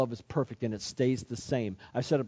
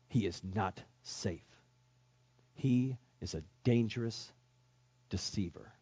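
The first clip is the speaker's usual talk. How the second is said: ordinary talk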